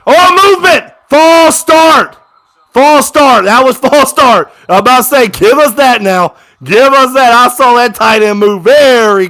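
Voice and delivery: high, loud fan outburst; excited yelling. Real, not acted. Fan yelling at the TV over a false start, excited. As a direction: shout it like you just spotted the flag.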